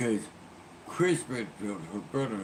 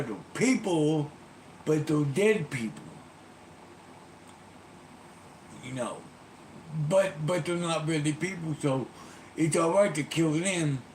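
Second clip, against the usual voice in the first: mock furious rant; 5 semitones higher than usual. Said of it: "Barry goes".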